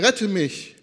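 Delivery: storyteller's urgent cry; cried out. A narrator's reading. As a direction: call for help with moderate force. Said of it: "schrie er".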